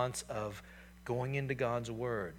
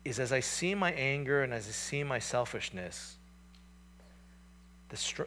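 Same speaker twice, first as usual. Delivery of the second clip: usual speaking voice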